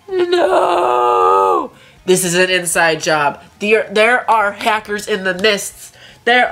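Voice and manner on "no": high, loud wail of defeat; anguished shout. Real, not acted. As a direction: cry out as the round is lost. Losing the match, cry shout.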